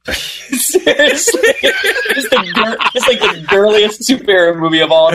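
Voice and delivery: high-pitched, drawn-out laughing speech. Real, not acted. Admitting to co-hosts the movie gets him emotional, laughing. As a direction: laugh through the words as they stretch.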